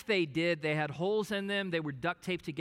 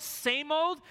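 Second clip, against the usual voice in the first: higher emphatic repeat; 9 semitones higher, 3 dB louder than usual. Acted.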